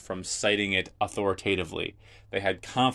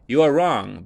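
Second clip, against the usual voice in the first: louder, firm; emphatic rebuke; 4 semitones higher, 9 dB louder than usual. Acted. Jesus tells the Sadducees they are wrong, firm and louder. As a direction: put weight on the rebuke.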